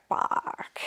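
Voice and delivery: breathy unvoiced whisper of dread; a hushed, half-scared whisper; whispered fuck. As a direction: mutter it under your breath, startled. Whispered dread at mini breakthrough.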